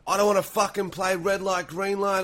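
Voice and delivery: whiny, melodramatic game contestant; overacted contestant. Punter acting in Squid Game parody, mildly hammed-up whine. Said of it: "Australian accent ... Korean overact".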